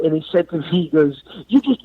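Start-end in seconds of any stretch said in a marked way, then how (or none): 1.5-1.8 high, cut-off angry start; pissed-off friend. Retold or imitated. Friend losing his temper on the street, high cut-off anger. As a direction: mimic the friend about to blow up. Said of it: "then he got all pissed off".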